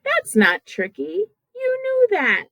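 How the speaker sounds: ordinary talk, nothing marked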